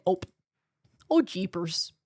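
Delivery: a hint of a Minnesotan aunt; Minnesotan accent.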